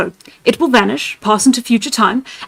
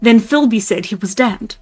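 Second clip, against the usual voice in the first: usual speaking voice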